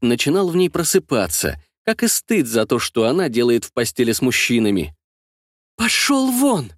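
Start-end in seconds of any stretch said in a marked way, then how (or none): 5.8-6.7 hissed. A narrator's reading. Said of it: "прошипела Анна".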